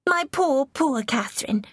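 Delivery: high, lightly voiced girlish pity; a touch of gushing sympathy; young princess pitying. A narrator's reading. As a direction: pity her, starting high and falling. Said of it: "Mary rushed on".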